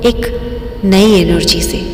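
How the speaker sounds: guided meditation. Real, not acted. Guide leading listeners into breathing and relaxation, meditative. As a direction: lead listeners unhurriedly through each breath.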